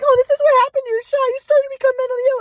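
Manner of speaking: high-pitched caricature of a worried mother; mom impression. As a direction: squeak it out as his insistent mom. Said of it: "But my mom was like".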